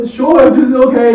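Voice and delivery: stunned, drawn-out agreement; surprised yes. Retold or imitated. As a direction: caught off guard, slowly saying sure. Foreigner suddenly joins, caught off guard.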